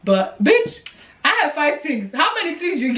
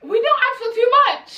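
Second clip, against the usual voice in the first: pitched way up, insisting; high indignant insist; 12 semitones higher than usual. Real, not acted. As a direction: say it like you're fed up. Emphatic complaint.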